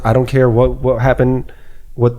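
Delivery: ordinary talk, nothing marked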